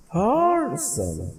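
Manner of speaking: Third very high and stretched, सन reduced; slow high third, unstressed सन; stress on first word. Shown as a demonstration. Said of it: "इसमें कहेंगे सन".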